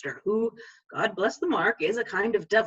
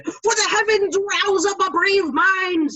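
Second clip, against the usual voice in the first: higher, louder, slow rallying fiend; rousing fiend; 11 semitones higher, 6 dB louder than usual. Retold or imitated. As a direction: rouse him like a devil cheerleader. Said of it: "says the fiend".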